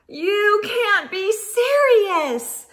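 high, slow exasperated moan. Acted. Squirrel finding her friends tangled, slow moan. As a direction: drag it out in disbelief. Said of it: "Squirrel moaned".

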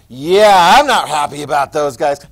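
loud, drawn-out "yeah" of disdain; mock a local unhappy with newcomers; exaggerated grumbling objection, starting loud; imitated disapproval. Voices people disdaining new immigrants.

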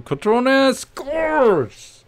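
high, loud, slow drawn-out roar; goal celebration. Real, not acted. Gamer celebrating his sub's goal, drawn-out roar.

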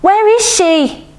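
very high, drawn-out demand; insistent high girl's voice; voice rising. A narrator's reading. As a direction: raise the pitch sharply and stretch the question. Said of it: "her voice rising".

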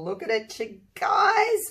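excited squeal rising on "guys"; thrilled squeal. Real, not acted. Showing viewers the finished pour, thrilled squeal. Delighted reveal of the painting.

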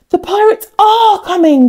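high, gasping alarm; gasped. Acted. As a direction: gasp it in sudden fright. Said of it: "gasped the villagers".

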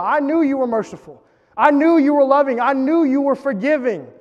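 high, heated repeated accusation; angry Jonah. Retold or imitated. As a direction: let Jonah's resentment rise. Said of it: "he's so angry, he's so upset at God".

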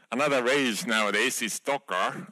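ordinary talk

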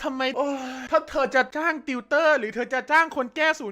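put-on high, snooty girlfriend voice; whiny girlfriend.

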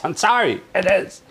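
normal talking voice

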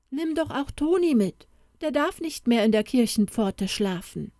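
put-on a small girl coaxing her father; girl pleading with her papa; pleading little girl. Girl Elsa begs father.

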